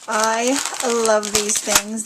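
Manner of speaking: ordinary talk, nothing marked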